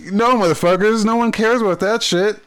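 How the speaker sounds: high-pitched, indignant comeback; exasperated retort. Acted. Fed-up fan answering the company's hype, in exasperation. Snapping back at the company's promotion.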